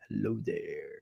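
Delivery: low, nasal sick voice; nasally deep voice. Retold or imitated. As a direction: imitate a sick nasal croak. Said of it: "my nasally deep voice out of nowhere".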